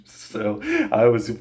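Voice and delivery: slow, high words broken by real laughter; laughing. Real, not acted. Remembering his dad losing the fish, real laughter. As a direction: laugh through it as you speak. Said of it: "dying laughing".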